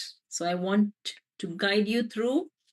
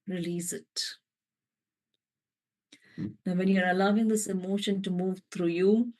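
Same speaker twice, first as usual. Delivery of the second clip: guided meditation. Acted. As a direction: let the words settle over them. Meditation guidance.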